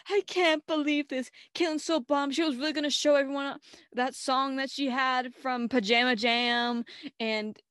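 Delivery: loud, high upset rant; mock dismay.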